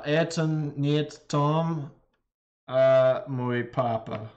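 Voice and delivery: это не Том, а мой папа, slowly sounded out; slow careful Russian. Shown as a demonstration. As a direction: read it slowly, syllable by syllable. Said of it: "slow repeat of app phrase".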